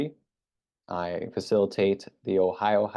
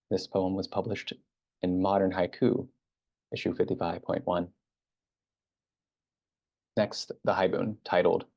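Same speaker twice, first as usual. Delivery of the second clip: usual speaking voice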